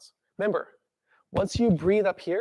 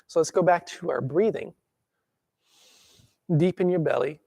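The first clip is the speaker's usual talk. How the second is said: guided breathing. Shown as a demonstration.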